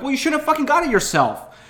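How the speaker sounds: very high, loud sarcastic yell; angry yell. Retold or imitated. Son yelling back at dad, loud angry. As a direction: snap it back at him.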